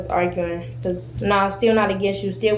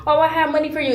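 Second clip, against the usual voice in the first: mocking quote; 7 semitones higher than usual. Mocking the girl's excuse.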